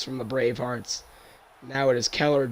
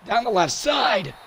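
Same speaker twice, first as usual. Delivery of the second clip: excited; 9 semitones higher, 5 dB louder than usual. Real, not acted. Deep pass play call.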